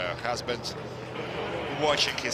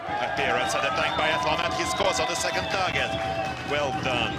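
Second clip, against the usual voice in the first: rising excitement as he scores; fast, high, louder call of the hit; excited commentary; 7 semitones higher, 4 dB louder, faster than usual. Real, not acted. Hit on the target in race.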